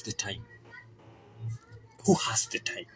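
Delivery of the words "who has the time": whispered aside, much softer. Real, not acted. Repeating the question to viewers as a hushed whisper. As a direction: breathe it out like a private afterthought.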